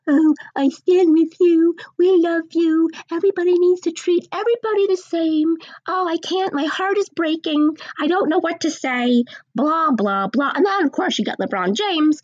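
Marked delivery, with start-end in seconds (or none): 0.1-9.2 high, whiny fake-sympathy voice; mocking celebrity. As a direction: parody a gushing celebrity, higher.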